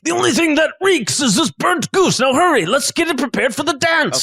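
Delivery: high-pitched, hurrying head chef; impatient chef. Acted. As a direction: play a bossy chef rushing the staff. Game master plays the chef.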